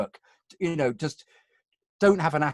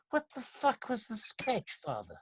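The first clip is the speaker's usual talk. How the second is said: exasperated question; 8 semitones higher, 9 dB quieter, slower than usual. Pretend. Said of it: "if he was alive, I'd say".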